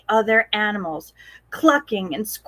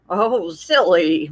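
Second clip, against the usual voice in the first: lower, slow mumble as if chewing; mumbled horse; 5 semitones lower, slower than usual. Acted. Horse munching oats answers Posy, slow low mumble. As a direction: mumble it through a mouthful of oats. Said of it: "mumbled Harry".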